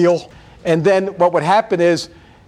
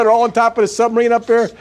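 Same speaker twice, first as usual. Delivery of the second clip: normal talking voice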